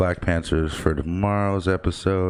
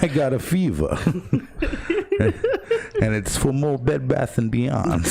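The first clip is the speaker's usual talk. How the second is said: higher, halting celebrity impression with laughs; Christopher Walken; 7 semitones higher than usual. Host riffing on the layoffs, celebrity impression. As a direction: play it as a goofy impression. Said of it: "So is Christopher Walken getting laid off?".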